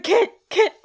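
high, loud mock coaching yell; shouted fight orders. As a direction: bark out fight moves like a ringside coach. [name] shouting fight directions.

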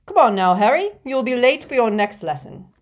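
loud, friendly and hearty; affable. A narrator's reading. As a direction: chivvy him along kindly. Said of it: "said Slughorn affably".

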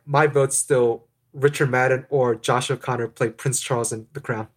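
usual speaking voice

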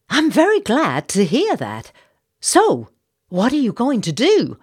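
high, loud, eager question; society matron eager for action; eager Southern lady. Acted. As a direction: push him for an answer. Missus Bartlett presses Jacob.

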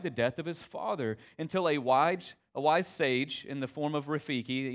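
ordinary delivery

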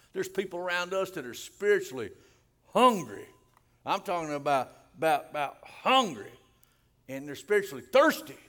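loud preacher punching the key words; emphatic preaching. Real, not acted. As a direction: hit "hungry" and "thirsty" hard and loud. Preaching at full force.

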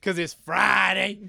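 loud, drawn-out party cheer; whooping that the weekend is here; excited shout. Real, not acted. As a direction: holler it high and slow. Gleefully answering "you know why?.

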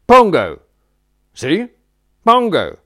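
high, loud calling out of a name; excited shout. Retold or imitated. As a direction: bellow the name like spotting an old friend. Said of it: "Like that".

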